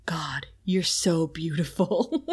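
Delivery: slow unvoiced seductive whisper; breathy whisper.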